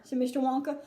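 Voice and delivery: ordinary delivery, nothing marked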